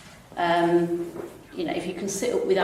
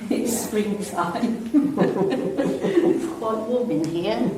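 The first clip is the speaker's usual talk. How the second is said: talking through laughter; light, giggly aside to the class; real laughing; 4 semitones higher, much slower than usual. Real, not acted. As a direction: chuckle through the words in an amused aside. Laughing about heating in spring.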